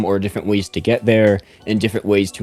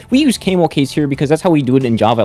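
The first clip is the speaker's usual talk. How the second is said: fast, higher prissy rule quote; mocking pedant; 5 semitones higher, faster than usual. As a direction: sneer it like a fussy coworker. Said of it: "Don't, like".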